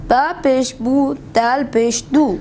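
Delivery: fast, hurried spelling of دال پیش دو; rushed. Real, not acted. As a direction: read it too quickly, running it together. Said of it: "تھوڑا آرام سے پڑھیں".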